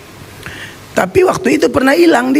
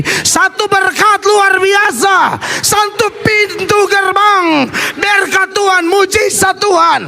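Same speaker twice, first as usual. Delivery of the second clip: preaching at full force; 9 semitones higher, slower than usual. Real, not acted. Preaching climax.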